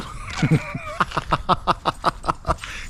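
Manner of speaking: high staccato giggle; long, high self-mocking laugh; giggling. Real, not acted. Vlogger amused by his own Canadian accent, giggling.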